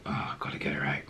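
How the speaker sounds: hushed whisper to himself; whispered thought. Retold or imitated. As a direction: whisper so she won't hear. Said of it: "He's like".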